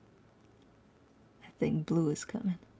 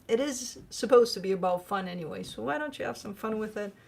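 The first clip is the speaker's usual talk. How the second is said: ordinary talk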